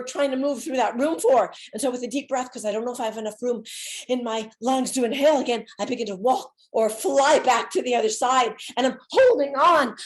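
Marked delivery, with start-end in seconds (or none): none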